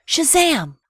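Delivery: high, loud, ringing Shazam; magic word. A narrator's reading. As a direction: announce the magic with a burst. Magic sound of the genie.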